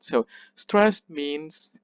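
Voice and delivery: usual speaking voice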